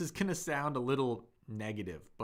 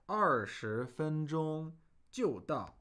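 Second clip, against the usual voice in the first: quoting him, slowed down; 4 semitones higher, much slower than usual. Retold or imitated. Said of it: "And he's saying".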